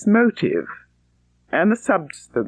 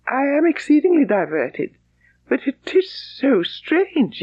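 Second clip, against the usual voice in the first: put-on higher, lively young woman's voice, slowing at the end; young Elizabeth; 9 semitones higher than usual. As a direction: play Elizabeth amused but puzzled.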